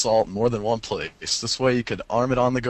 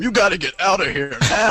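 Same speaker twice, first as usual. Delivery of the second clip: higher-pitched mock scream; screaming announcer; 8 semitones higher, 4 dB louder than usual. Announcer warns players about the bomb, mock scream. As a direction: play a panicked game announcer, half laughing. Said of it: "have the announcer scream".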